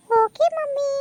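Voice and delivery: put-on high bright girlish okay; daughter's voice. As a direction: chirp it like a teen girl. Daughter answers in English.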